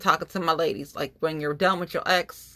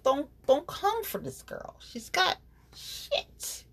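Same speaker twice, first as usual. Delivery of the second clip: much higher sassy voice, drawn out at the end; sassy warning; 11 semitones higher, 3 dB quieter, slower than usual. Real, not acted. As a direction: warn them off with playful attitude.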